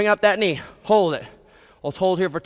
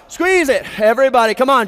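usual speaking voice

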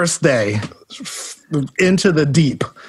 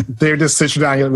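usual speaking voice

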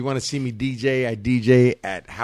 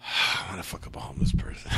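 unvoiced, softer whisper; whispered joke; 6 semitones lower, 9 dB quieter, faster than usual. Real, not acted. To his cohost, a whispered dark joke.

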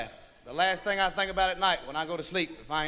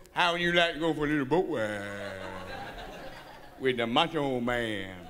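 macho voice; 10 semitones lower, 4 dB quieter than usual. Acted. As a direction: play it smooth and macho. Guy growing braver, macho voice.

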